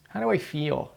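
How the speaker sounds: soft, whiny complaining tone. Shown as a demonstration. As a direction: whine it out quietly. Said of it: "Insert whiny voice".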